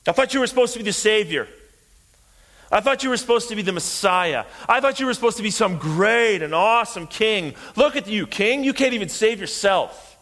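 high, fast jeering taunts; railing mockery. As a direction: pile on the taunts, pitched high. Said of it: "Railing against him".

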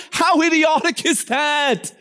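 ordinary delivery

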